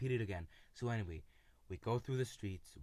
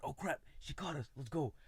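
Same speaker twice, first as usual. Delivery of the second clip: put-on panicked kid; 6 semitones higher than usual. Kids fleeing after being caught.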